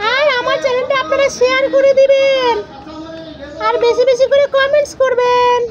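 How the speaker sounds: put-on high little boy asking viewers to like; a toddler's sweet plea to the audience; child voice. Little son asking viewers to like and comment, in a high child voice.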